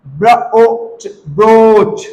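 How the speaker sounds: ब्र, ओ, च apart, then long ब्रोच; sounded out slowly. Shown as a demonstration. Teacher showing long ओ, slowly sounded out. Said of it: "ओ pronounce करते हैं".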